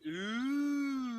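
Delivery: crowd ooh. Shown as a demonstration. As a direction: lead the crowd in a big ooh. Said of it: "Everyone says".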